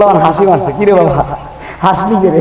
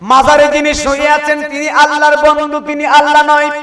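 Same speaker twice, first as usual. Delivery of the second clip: raised high preaching call; preaching cry; 8 semitones higher than usual. Acted. Preacher to the gathered crowd, high calling voice. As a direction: fling the line up high to the crowd. Preacher repeats the shrine line.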